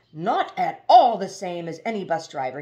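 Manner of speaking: loud offended shriek. Acted. Slick gent shrieking at Haroon, insulted and loud. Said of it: "he shrieked".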